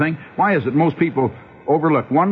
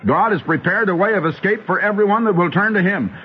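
higher, insistent pulpit voice; fervent preaching; 6 semitones higher than usual. Acted. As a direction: lift them with mercy. Preacher extols God's mercy.

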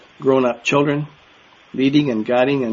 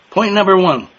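"Point number one" called out higher and louder; higher announcing call; 6 semitones higher, 6 dB louder than usual. Shown as a demonstration. Preacher announcing his first point to the hall, loud. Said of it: "restarts the announcement".

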